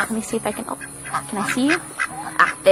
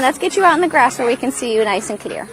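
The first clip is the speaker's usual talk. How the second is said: normal talking voice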